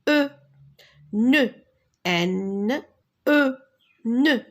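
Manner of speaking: N, E, then blended into "Ne"; teacher modelling "Ne" with pauses; spelling aloud. Shown as a demonstration. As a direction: sound out N and E separately, then say "Ne". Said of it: "on a la lettre N".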